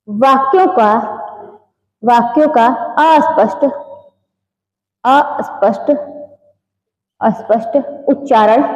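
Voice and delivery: word by word with long pauses; slow dictation, leaving time to write. Real, not acted. Teacher dictating notes to students, dictation.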